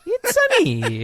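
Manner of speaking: very high, slow cartoon plumber voice; high falsetto catchphrase, drawn out; Mario.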